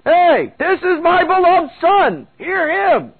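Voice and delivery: high, loud, slow proclamation; commanding divine announcement, drawn out; booming voice of God. Acted. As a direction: thunder it down from the cloud. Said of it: "God's voice, saying".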